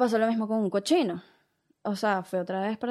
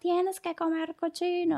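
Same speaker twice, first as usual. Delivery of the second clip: insistent family; 8 semitones higher, slower than usual. Retold or imitated.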